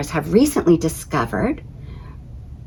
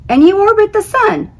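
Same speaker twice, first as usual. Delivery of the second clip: much higher, louder insistence; insisting planet; 5 dB louder than usual. Acted. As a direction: press the point eagerly. Planet character voiced in story.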